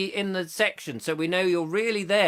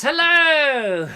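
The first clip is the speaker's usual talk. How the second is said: chirpy hello; 9 semitones higher, 6 dB louder than usual. Retold or imitated. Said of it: "Karen Holroyd says".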